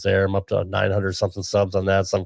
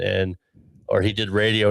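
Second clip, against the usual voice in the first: normal talking voice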